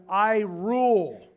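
booming slow declaration; megalomaniac rant. Pretend. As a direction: proclaim it slowly and loudly. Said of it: "could be translated".

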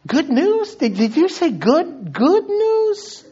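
high, loud astonished questioning; mock incredulous. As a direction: squeal the question in exaggerated disbelief. Unbeliever's disbelief at the claim.

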